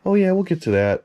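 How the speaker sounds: high, breezy brush-off of a collector friend; dismissive brush-off. Retold or imitated. Mimicking the friend Frank brushing off the begging collector, breezy and high. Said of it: "kinda blow me off".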